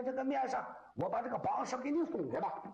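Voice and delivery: ordinary delivery, nothing marked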